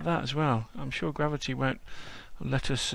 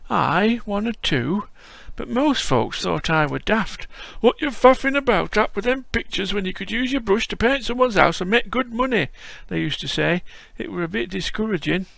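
put-on higher, louder old Yorkshire man's voice; old Yorkshire grandad greeting a visitor; frail old Yorkshireman; 7 semitones higher, 6 dB louder than usual. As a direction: play a creaky old Yorkshire lad-caller. Voicing old Yorkshire Bob.

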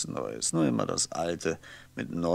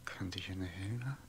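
whisper to himself; 12 dB quieter than usual. Acted. Martin muses under his breath.

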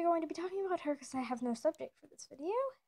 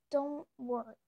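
ordinary delivery

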